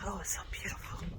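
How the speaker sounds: soft awed whisper. Real, not acted. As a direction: breathe it out in awe.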